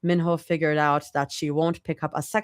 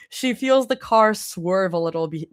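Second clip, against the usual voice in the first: plain reading voice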